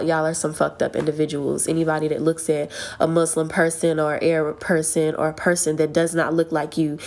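usual speaking voice

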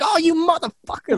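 high-pitched retold outburst at the cat; mock startled curse. Cursing at cat grabbing his leg.

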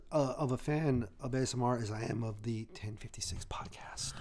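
ASMR whisper; breathy close-mic whisper. Acted. Joking about ASMR, whispers.

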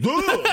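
loud high exasperated ugh; disgusted groan. Real, not acted. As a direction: groan in annoyance. Annoyed groan.

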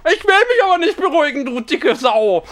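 high, loud tantrum shout; mock furious yelling; shrill tantrum. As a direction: throw a screaming fit. Puppet tantrum after calming attempt.

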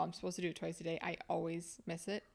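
usual speaking voice

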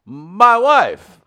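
loud, high, badly faked British; hammed-up mock British exclamation; British accent. Comic performing on his co-host's request, bad British accent. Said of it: "And your British accent, say "my wife".

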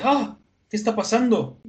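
high-pitched acted surprise; imitated startled question; startled cry. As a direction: blurt it in mock alarm. Acts out startled Luffy.

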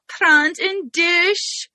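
imitating a friend's little French accent. Host quoting her friend's shower announcement, French accent. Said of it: "in your little French accent".

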